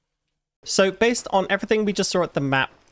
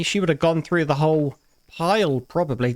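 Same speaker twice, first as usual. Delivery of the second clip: normal talking voice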